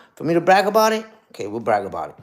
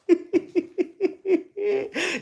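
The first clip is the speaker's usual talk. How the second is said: high, soft giggle; long high-pitched run of laughter; laughing; 13 semitones higher, 5 dB quieter than usual. Real, not acted. Laughs after his own insult.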